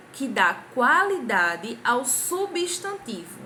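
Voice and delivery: usual speaking voice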